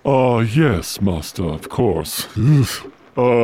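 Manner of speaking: low, croaky servant voice; hunchback assistant. Acted. Igor obeys the master.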